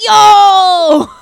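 loud startled yell of recognition; startled shout. Retold or imitated. Recognizing the dreadlocks guy at the door, a loud startled shout. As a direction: yell it out in shock.

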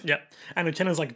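usual speaking voice

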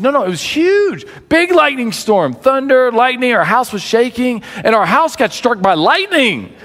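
high-pitched, acted storm drama; excited recounting. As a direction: imitate a wife gushing about a disaster. Wife recounts huge storm in story.

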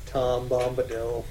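usual speaking voice

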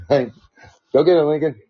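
loud, drawn-out call to a dog; playful sic-him command. Pretend. Host setting Lincoln on the giggler, playful command. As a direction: pretend to send the dog after someone.

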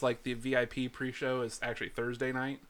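ordinary talk, nothing marked

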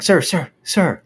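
mock-panicked appeal; panicky college kid. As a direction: imitate a nervous kid trying to interrupt. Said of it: "in a very panicky voice".

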